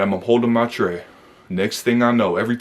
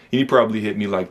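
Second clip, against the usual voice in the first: plain reading voice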